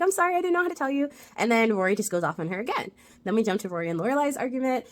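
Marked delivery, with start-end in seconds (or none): 0.0-1.1 high, fast, anxious sorry; rushed apology. Retold or imitated. Lane at the bus stop, apologizing fast and high. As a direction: blurt the apology quickly, pitched up.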